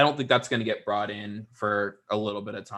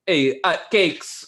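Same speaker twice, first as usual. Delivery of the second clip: imitating a cheesy Italian accent, much higher and louder; mock Italian accent; 6 semitones higher, 4 dB louder, slower than usual. Mocking an actor she finds annoying, a cheesy Italian accent. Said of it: "some type of Italian accent".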